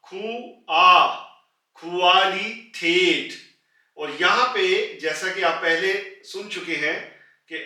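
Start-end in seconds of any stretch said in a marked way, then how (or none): 0.0-1.1 खू then आ, split apart and loud; sound by sound. Shown as a demonstration. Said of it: "पहले कू बोला और फिर उसके बाद आ". 1.8-3.3 क्वालिटेट stretched out very slowly; very slow model word. Shown as a demonstration. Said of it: "पहले कू बोला और फिर उसके बाद आ".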